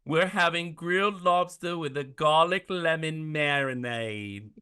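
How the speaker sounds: imitating Asia's New Zealand accent; Kiwi impression. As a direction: play the Kiwi chef greeting guests. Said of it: "say an Asia quote like the Kiwi Queen".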